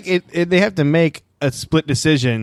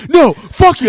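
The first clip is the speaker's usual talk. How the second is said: higher, louder "no, fuck you"; mock angry yell; 11 semitones higher, 7 dB louder, slower than usual. Bartender still angry a week later, mock yelling. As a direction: snap back at them, still furious. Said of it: "just still same level of anger".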